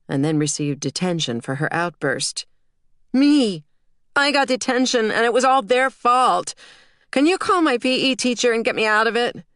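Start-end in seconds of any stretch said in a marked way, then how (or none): 3.1-9.4 put-on high, loud whiny kid voice; indignant child. A narrator's reading. Daughter complains to mom about detention, high indignant whine. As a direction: protest like a wronged little girl.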